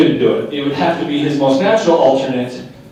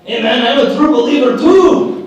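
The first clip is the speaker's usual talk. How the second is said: louder, higher mock Mexican accent; 8 semitones higher, 6 dB louder than usual. Trying a different accent for the doctrine, light Mexican accent. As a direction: try the doctrine again in a hammed-up Mexican accent. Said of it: "I'll try a different accent".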